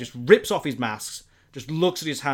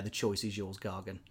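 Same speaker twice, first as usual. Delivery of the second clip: low, much softer menacing line; ominous hush; 6 semitones lower, 13 dB quieter than usual. Acted. Mysterio offering Gargan a choice, low sinister hush.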